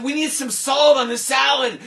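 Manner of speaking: loud high shout; loud shouted demand; shouting guests. Acted. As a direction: demand it down the table.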